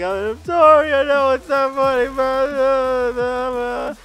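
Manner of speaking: high, wailing, garbled apology; hammed-up crying, words melting into wails; mock blubbering. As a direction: imitate a bawling kid losing control of words. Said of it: "not being able to control my own words".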